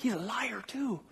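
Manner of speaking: high, hushed mock accusation; imitated accusing listeners. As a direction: mimic a scandalized congregation, softly and high. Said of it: "some of you are like".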